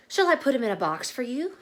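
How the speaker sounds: a hint of a polite saleswoman; sales lady, lightly voiced. Sales lady offering Lisa a box, lightly voiced. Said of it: "the sales lady asked".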